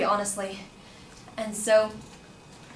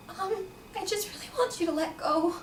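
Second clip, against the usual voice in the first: high, softer, hesitant request; retold timid insistence; nervous plea; 9 semitones higher, 5 dB quieter than usual. Student asking the man to let go, nervously. Grabbed girl asking to be released.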